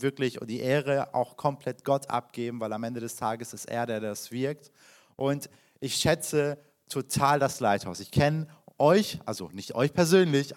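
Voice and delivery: ordinary talk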